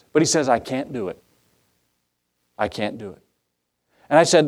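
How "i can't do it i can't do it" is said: softer, defeated I can't do it; mild despairing quote. Retold or imitated. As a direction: let the young man's words sink, beaten. Young man voiced despairing.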